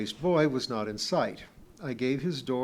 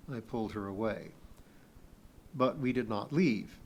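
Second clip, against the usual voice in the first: usual speaking voice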